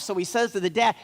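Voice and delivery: ordinary delivery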